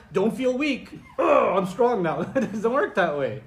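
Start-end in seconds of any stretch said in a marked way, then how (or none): 0.1-2.2 hammed-up "I'm strong now", louder and slower; mock instant resolve. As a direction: play the absurd sudden cure. Mock instant switch to strength.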